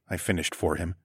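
usual speaking voice

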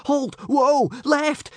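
very high, loud panicked yells; frantic rider shouting to stop; screech. Acted. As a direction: screech at the bolting dragon. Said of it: "screeched Hiccup".

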